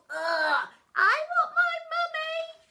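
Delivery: put-on high, loud crying child; whiny child wail. A narrator's reading. Young kid realising he ate tadpoles, high wail. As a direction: wail for mummy.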